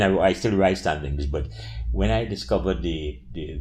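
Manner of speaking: ordinary talk, nothing marked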